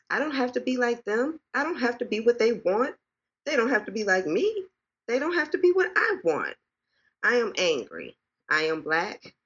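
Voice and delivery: measured, emphatic poetry recital; spoken word poem. Acted. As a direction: perform it like a slam poem to a listening room.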